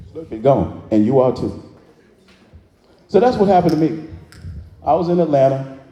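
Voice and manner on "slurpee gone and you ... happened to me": usual speaking voice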